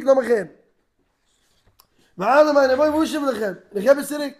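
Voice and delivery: loud, high-pitched dramatic retelling; acted challenge. Acting out challenge and people's replies.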